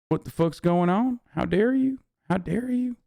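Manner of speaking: high-pitched put-on outrage; mock shocked indignation. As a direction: play at being stunned and affronted. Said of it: "doing the surprise Pikachu face when they are like".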